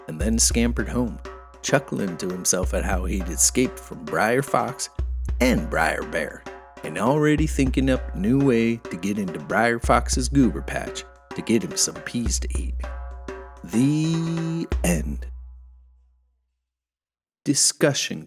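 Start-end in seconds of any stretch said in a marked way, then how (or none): none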